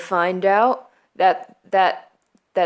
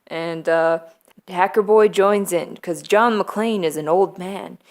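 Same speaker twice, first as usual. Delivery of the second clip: normal talking voice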